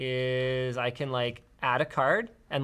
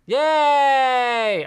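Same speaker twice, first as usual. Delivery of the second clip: excited cheer; 10 semitones higher, 10 dB louder than usual. Real, not acted. Cheering on reaching channel.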